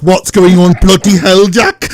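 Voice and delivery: loud, high mock outburst; hammed-up Welsh woman calling for Jack; comic exclamation in put-on Welsh; mock Welsh panic. Mocking Gwen's panic in recap.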